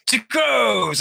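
high, shouted "Two crows!"; hammed-up gleeful cry of "Two crows!"; excited shout. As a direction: yell "Two crows!" with glee. Quoting Rick's excited line.

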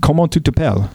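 Comment tu t'appelles? asked in French, rising; French phrase. Shown as a demonstration. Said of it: "I can ask you your name".

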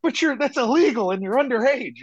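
high-pitched, stammering mock shock; scandalized protest. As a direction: mimic a flustered goody-goody objecting. Mimics shocked religious classmates objecting.

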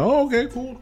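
high, lightly approving voice; polite interest. Retold or imitated. Viewer not knowing who that character was, high polite reaction. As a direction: lift it high at normal volume.

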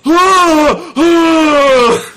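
high happy yelling cry; excited shout. Pretend. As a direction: let out a gleeful yell. End of excited review reading.

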